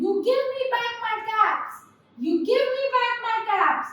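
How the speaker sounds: high-pitched insistent demand; scolding peddler. A narrator's reading. Peddler demanding his caps from monkeys, high scolding.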